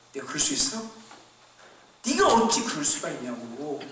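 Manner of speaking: ordinary talk, nothing marked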